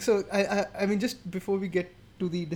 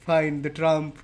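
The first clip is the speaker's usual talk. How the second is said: ordinary delivery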